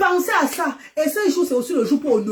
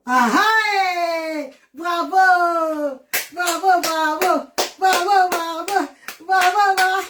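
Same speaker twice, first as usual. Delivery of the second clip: delighted praise; 6 semitones higher, slower than usual. Real, not acted.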